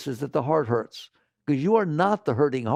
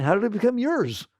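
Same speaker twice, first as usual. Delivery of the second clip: usual speaking voice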